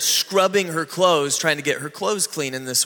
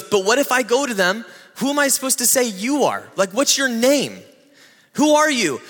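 higher, pleading Moses questions; anxious Moses; 5 semitones higher than usual. Retold or imitated. Moses demanding God's name, higher anxious pleading. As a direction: press God nervously for a name. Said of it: "is he goes".

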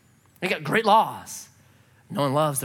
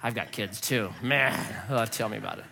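lower, softer, knowing sympathy; sympathetic cop; 12 semitones lower, 8 dB quieter than usual. Retold or imitated. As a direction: play a fellow dad, low and soft. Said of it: "he's like".

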